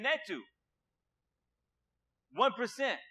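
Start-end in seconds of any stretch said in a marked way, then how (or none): none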